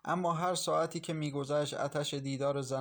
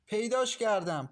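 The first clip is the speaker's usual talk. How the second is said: high, loud cry of discovery; loud triumphant call; excited shout; 6 semitones higher, 4 dB louder than usual. A narrator's reading. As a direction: shout it out, she's found it. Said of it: "با صدای فریاد ماهرخ".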